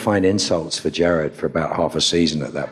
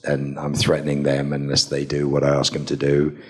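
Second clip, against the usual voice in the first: ordinary talk, nothing marked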